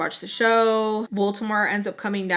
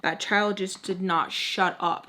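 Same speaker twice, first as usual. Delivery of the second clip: ordinary delivery, nothing marked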